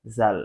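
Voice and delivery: জাল said slowly and clearly, tongue kept off the upper teeth; teacher modelling জাল with the tongue clear of the teeth; letter demo. Shown as a demonstration. Teacher shows students how জাল should sound. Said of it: "তাহলে উচ্চারণ হবে".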